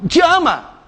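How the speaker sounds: ordinary delivery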